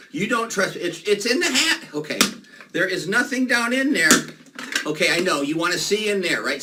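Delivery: ordinary talk, nothing marked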